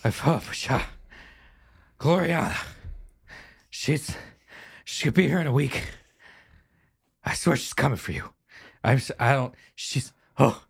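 winded, broken phrases between gasps; panting, halting delivery; out of breath. Acted. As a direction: force the news out while catching your breath. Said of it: "Oh, God, I'm out of shape".

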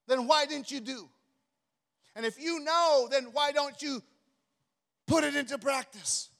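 loud, forceful preaching. Acted. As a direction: preach it hard to the congregation.

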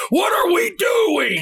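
high, drawn-out frantic shout; hammed-up Farley-style yell; Chris Farley on steroids. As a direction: blow up like an over-the-top fired-up coach. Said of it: "it's like Chris Farley on steroids".

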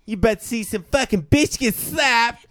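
loud, high, brash yell; Jersey Shore shout. Retold or imitated. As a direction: yell it like a reality star.

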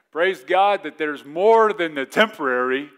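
loud, slow exultant praise; preaching shout. Real, not acted. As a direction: proclaim it with joy. Preaching climax.

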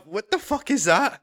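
lightly hammed-up incredulous question; mock disbelief. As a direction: act out being baffled by what you see. Acts out disbelief at tiny box.

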